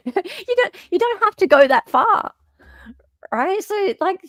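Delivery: high, disbelieving exclamations; can't believe what the lawyers did; incredulous. Real, not acted. Incredulous rant about the lawyers.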